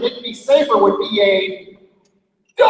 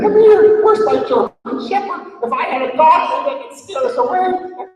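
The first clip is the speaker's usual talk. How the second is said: high, loud panicked calling; nervous calls; 12 semitones higher, 4 dB louder than usual. Acted. Nervous Bob calling his dog for help, high panicked. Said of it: "he started going".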